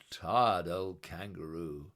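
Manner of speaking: plain reading voice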